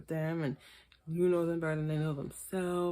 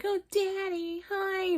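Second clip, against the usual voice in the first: sugary phone greeting; 13 semitones higher, slower than usual. Retold or imitated. Host mocking Maila's phone greeting to Quaylon in a very high coo. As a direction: gush it up high like a smitten girlfriend. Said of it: "you're like".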